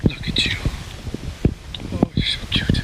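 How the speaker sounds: breathy whisper to a fawn. Real, not acted. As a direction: whisper it so the deer stays.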